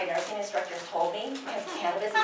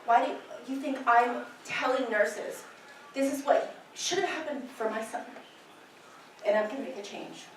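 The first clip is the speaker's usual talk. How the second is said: getting emotional; 7 dB quieter than usual. Real, not acted. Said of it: "I'm sorry to get emotional".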